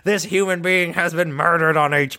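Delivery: mock-horrified play-by-play; J R impression. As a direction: announce a disaster from ringside. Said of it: "this guy J R announce wrestling".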